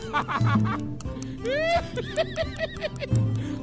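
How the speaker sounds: long, shrieking high laugh; staged high-pitched cackle. Acted. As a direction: peals of performed laughter. Staged laughter after slogan.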